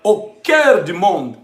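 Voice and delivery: normal talking voice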